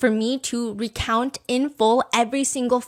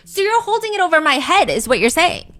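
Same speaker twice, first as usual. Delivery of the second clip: heated accusation; 6 semitones higher, 6 dB louder than usual. Acted. Girlfriend's heated reply.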